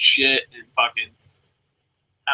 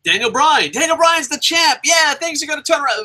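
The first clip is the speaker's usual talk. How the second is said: much higher, bright naive cheer; mock hopeful fan; 11 semitones higher than usual. Fan hoping the new champ turns things around, high mock cheer. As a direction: play a gullible fan cheering on.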